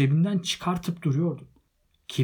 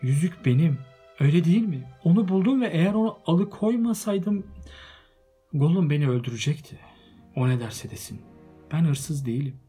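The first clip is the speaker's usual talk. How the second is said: frightened plea; 6 semitones higher, 7 dB louder than usual. A narrator's reading. Bilbo frightened pleading.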